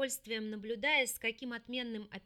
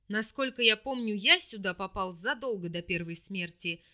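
low, louder demon voice; 3 semitones lower, 4 dB louder than usual. A narrator's reading. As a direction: coolly counter as the demon. Demon argues with heroine.

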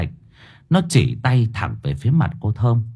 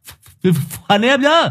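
put-on high stammering boy's voice, begging; a high stuttering plea for his share of the fruit; hesitant fat boy; 11 semitones higher than usual. A narrator's reading. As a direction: stutter it out high and pleading like a nervous chubby kid. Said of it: "bị bạn lôi đi mà vẫn cứ lấn cấn".

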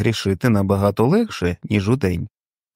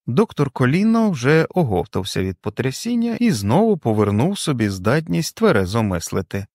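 ordinary delivery, nothing marked

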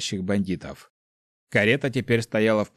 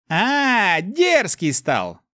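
high, loud, amused sneer; Mefis, mocking; 10 semitones higher, 5 dB louder, much slower than usual. A narrator's reading. Inner demon mocking the hero's back talk, gleefully arrogant. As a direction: draw it out high, taunting the cheeky boy. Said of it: "Мефис будто усмехнулся".

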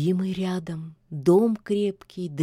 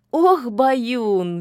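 merry; 5 semitones higher, 6 dB louder than usual. A narrator's reading. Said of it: "веселилась ведьма".

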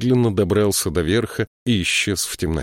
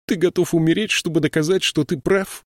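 higher, incredulous pleading; exasperated plea; 11 semitones higher than usual. A narrator's reading. Siskwi pleads with stubborn Binabik, incredulous. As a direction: plead in disbelief.

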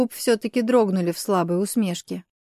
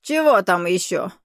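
put-on grumbling old man. A narrator's reading. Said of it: "буркнул Толбан".

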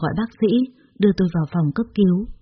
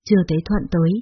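usual speaking voice

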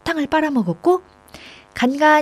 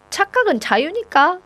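suppressed giggles; 9 semitones higher than usual. A narrator's reading. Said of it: "겨우 웃음을 참으며".

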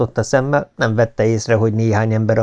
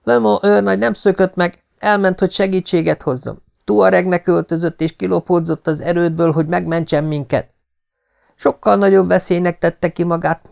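high-pitched, earnest woman's plea; indignant daughter; 8 semitones higher than usual. A narrator's reading. Daughter defending the major to the mob, high and earnest. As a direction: defend him in a raised, urgent pitch. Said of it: "mondta elfulladva".

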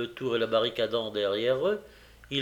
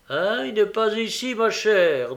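higher, louder gruff officer; policeman's voice; 8 semitones higher, 6 dB louder than usual. A narrator's reading. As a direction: brush her off as an officer. Policeman answers Nancy.

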